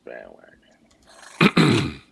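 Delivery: very slow, low, stretched out; drawn-out mocking taunt. Real, not acted. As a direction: drag the word out to mock him. Teasing a fan as bandwagon.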